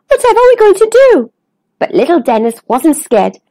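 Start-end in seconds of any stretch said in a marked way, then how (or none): 0.1-1.3 high, rushed fretting; panicky. Acted. Friends trapped inside the whale, panicky. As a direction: rush it out high and anxious.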